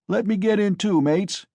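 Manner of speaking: loud high call; eager shout. A narrator's reading. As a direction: shout to join the fun. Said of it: "shouted a young man in the crowd".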